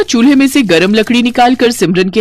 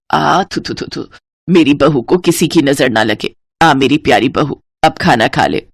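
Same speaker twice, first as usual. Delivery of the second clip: put-on lower, fond older woman warding off the evil eye; lower, tender mock spitting; doting mother-in-law; 4 semitones lower than usual. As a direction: coo over her new daughter-in-law.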